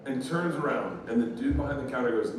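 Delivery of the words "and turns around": normal talking voice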